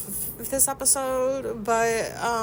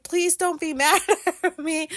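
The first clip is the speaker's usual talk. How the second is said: laughing plea; 8 semitones higher than usual. Retold or imitated. Pleading to partner while laughing.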